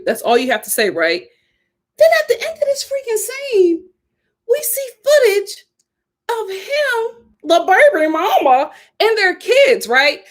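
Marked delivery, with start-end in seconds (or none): none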